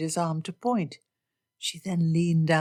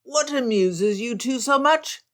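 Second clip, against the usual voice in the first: higher, louder call across the cave; yelled out; 6 semitones higher, 3 dB louder than usual. Acted. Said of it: "Bingley yelled out".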